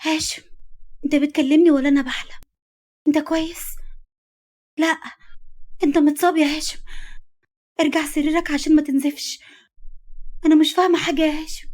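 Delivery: high, bewildered, agitated questioning; frantic disbelief. A narrator's reading. Woman seeing her wounded lover standing, frantic and shocked. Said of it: "بصت على ملامحه بجنون".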